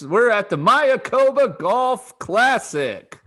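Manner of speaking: high, loud, slow announcer call; excited radio voice. Pretend. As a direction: boom it out like a radio host. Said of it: "how my excited radio voice sounds".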